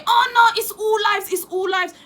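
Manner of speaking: high, loud mock whine; hammed-up offended protest; mocking a triggered person. Mocking someone who shouts all lives matter, in a high whine. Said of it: "nobody can ever get triggered and say".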